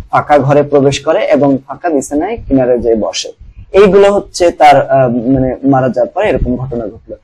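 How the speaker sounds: usual speaking voice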